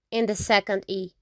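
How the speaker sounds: usual speaking voice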